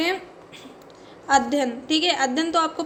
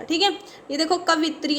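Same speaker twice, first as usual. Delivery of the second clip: usual speaking voice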